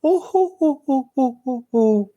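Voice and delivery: high, descending "oh-ho-ho" titter; faint girlish laugh. Retold or imitated. GM imitates an older woman heard entering the building, faint high laugh. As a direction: imitate a distant, too-young laugh in falling falsetto. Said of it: "There's a very faint".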